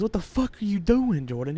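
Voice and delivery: put-on high, slowed disbelieving yell at a drunk cousin; exasperated yell. Cousin yelling at drunk Jordan who wrecked the table, exasperated.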